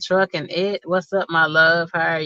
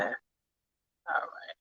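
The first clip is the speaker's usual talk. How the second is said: whisper; 5 semitones lower, 15 dB quieter than usual. Real, not acted. Quiet aside before guest joins.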